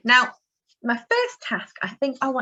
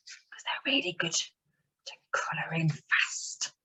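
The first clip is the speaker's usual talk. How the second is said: whisper; 2 semitones lower, 8 dB quieter, slower than usual. Real, not acted. Whispered aside while colouring.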